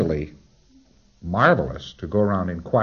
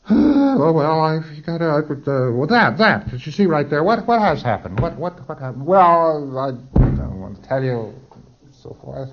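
higher, louder stammering dodge; flustered PC; 6 semitones higher, 5 dB louder than usual. Retold or imitated. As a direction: stall and stumble like a caught client. PC stammering a confession.

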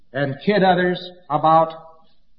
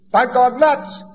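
mock crazy fellow; 7 semitones higher, slower than usual. A man rushing up to tell he's gone nuts, mock cheerful. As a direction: announce it like great news. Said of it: "somebody rushes up and he says".

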